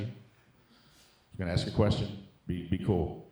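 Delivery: hushed confiding. Acted. Comic to crowd, asking them to keep a secret, hushed. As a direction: drop low and slow, half-whispered, conspiratorial.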